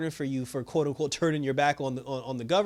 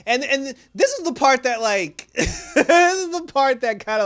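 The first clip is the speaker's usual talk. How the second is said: high, loud, through laughter; laughing outburst; 9 semitones higher, 8 dB louder than usual. Real, not acted. Reviewer recalls the scene that killed him, laughing. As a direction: crack up while setting up the best part.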